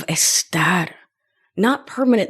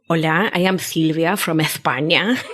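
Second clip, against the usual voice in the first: playful mock Castilian Spanish accent with a lisp; Silvia, Spanish lisp; 3 semitones lower than usual. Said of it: "all of their lisping".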